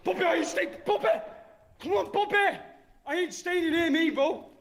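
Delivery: high frantic pleading; panicked. Real, not acted. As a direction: beg to leave in a panic. Ghost hunter panicking, fleeing.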